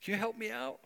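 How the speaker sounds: high pleading questions; lightly voiced eager girl; eager young woman. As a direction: beg eagerly like a fan. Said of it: "she's like".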